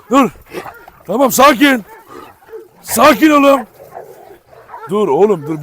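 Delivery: loud, high command to calm down; settling the dog. Real, not acted. Owner calming excited Escobar, loud high command. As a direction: firmly tell him to calm.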